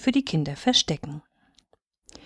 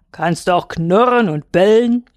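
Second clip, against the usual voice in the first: louder, lightly voiced big dog; challenging wolfhound; 5 dB louder than usual. A narrator's reading. Wolfhound tests the little hare, louder challenge. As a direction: push the question out with a touch of gruffness.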